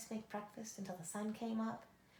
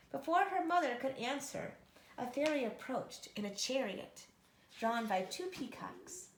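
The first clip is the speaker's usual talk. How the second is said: usual speaking voice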